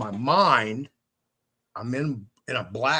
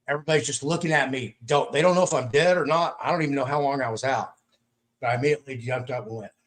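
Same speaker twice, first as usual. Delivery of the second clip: usual speaking voice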